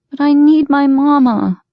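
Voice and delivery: put-on high, loud pleading child; whiny little girl plea. A narrator's reading. Cassie voiced high.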